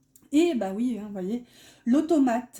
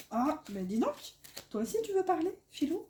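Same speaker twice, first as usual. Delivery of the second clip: higher, softer cooing at the cat; talking to the cat; 5 semitones higher, 6 dB quieter than usual. Real, not acted. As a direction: coo to the pet Filou. Talking fondly to her pet.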